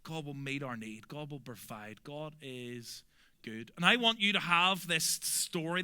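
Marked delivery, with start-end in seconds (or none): none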